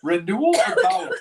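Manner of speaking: high, loud grand announcement; loud proclamation. Pretend. Repeats after stumble.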